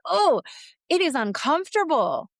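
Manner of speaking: high, loud imitated discomfort; mock distress. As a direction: ham up the client squirming. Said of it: "In a like".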